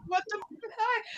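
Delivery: very high, hushed mock shock; imitating her younger self's disbelief; stunned little girl. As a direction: squeak it softly like a bewildered child. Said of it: "I was just a little girl".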